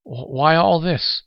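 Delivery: usual speaking voice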